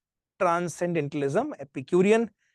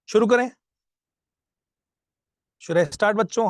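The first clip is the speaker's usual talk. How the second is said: eager call to class; 5 semitones higher, 4 dB louder than usual. Real, not acted. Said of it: "teacher rallying class to start".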